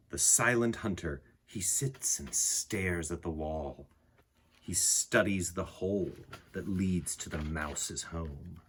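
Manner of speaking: gentle poem reading. A narrator's reading. Reading the picture book poem to listening children, calm and soft. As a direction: read it like a quiet bedtime poem.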